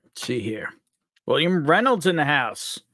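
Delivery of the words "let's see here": whispered to himself while reading chat; soft whispered aside. Real, not acted. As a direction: breathe it out under your breath, no voice. Streamer muttering while scanning chat.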